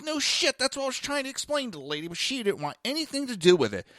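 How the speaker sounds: high-pitched, exasperated retold protest; exasperated retort. Retold exasperated argument with shelter worker.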